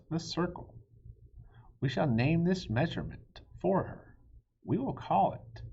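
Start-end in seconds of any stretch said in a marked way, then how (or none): none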